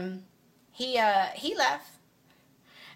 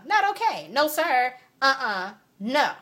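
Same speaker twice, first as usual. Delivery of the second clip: much higher and louder sassy refusal; sassy emphatic refusal; 7 semitones higher, 6 dB louder, slower than usual. Real, not acted. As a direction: wag the finger with your voice.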